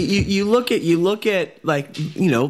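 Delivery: normal talking voice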